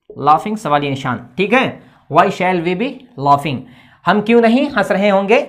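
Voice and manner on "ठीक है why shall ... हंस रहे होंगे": ordinary talk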